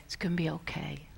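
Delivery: put-on hushed comforting whisper to a scared child; soothing whisper. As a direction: whisper it like a parent calming a frightened kid. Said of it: "They'd say".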